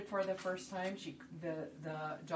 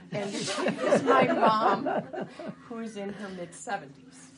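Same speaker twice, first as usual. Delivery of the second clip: loud, higher, laughing introduction; 4 semitones higher, 13 dB louder, slower than usual. Real, not acted. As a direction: crack up while introducing your scene partner. Cracking up setting the scene.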